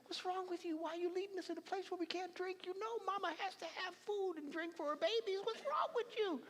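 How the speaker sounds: high-pitched nagging complaint; complaining Israelites. Acted. Israelites complaining to Moses about water, whiny. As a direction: whine at Moses like a fed-up mother. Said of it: "looked at Moses and said".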